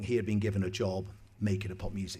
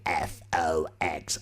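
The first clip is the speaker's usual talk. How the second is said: slow, lower, drawn-out jingle voice; jingle voiceover; 5 semitones lower, 4 dB louder, much slower than usual. Retold or imitated. DJ voices his own show jingle in the studio, slow and lower. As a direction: spell it out like a radio ident. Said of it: "sat in the studio going".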